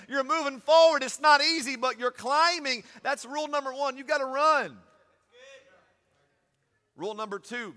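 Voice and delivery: loud, high preaching with shouted peaks; full-force preaching. Acted. Pastor driving the race rules home to church, shouting preaching. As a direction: preach it hard, building to the shout.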